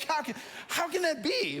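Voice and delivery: high, laughing disbelief; a baffled pastor hearing the range, high and laughing. Retold or imitated. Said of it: "And they're like".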